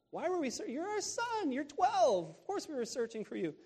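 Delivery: high, squeaky exasperated mother voice. Acted. Mary answering her twelve-year-old son, high and exasperated. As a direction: pitch it way up, a mother at her wits' end.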